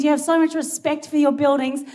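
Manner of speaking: ordinary talk, nothing marked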